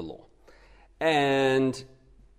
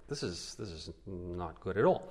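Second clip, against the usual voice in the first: much lower, softer voicing of the listeners; imagine a listener's dismay, very low and quiet; dismayed aside; 10 semitones lower, 12 dB quieter than usual. Preacher voices the listeners' reaction to Paul, a low, quiet aside. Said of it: "And you're like".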